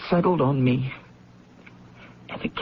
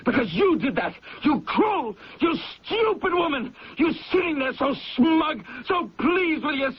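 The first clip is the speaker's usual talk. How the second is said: higher, shouted accusation; raging shout; 7 semitones higher than usual. Acted. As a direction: scream your hatred at her. Son screams at smug mother.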